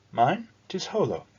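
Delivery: high, bright-voiced girl conceding grudgingly; a young girl allowing it with a sly reluctance; reluctant girl. Hullo introduces herself.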